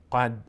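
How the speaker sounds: قد with the final d shut dead; stopped d in قد, no bounce; قد without qalqala. Shown as a demonstration. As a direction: end قد with no release.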